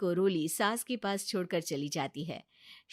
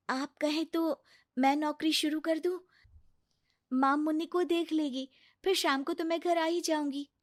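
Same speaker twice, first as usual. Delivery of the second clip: put-on higher, earnest young wife proposing to work; hesitant offer; 6 semitones higher than usual. Roli offering to take a job to her husband, earnest. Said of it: "और कहती है".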